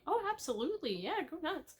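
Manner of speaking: lightly playing the cheerful owner saying yes; cheery horse owner. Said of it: "And she was like".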